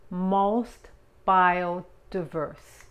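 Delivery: "Most biodiverse" stretched very slowly and loud; slow model. Shown as a demonstration. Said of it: "teacher models slowly". ("Most biodiverse" muy despacio y fuerte)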